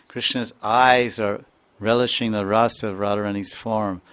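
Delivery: usual speaking voice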